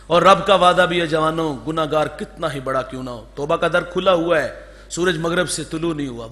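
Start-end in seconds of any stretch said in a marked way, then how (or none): none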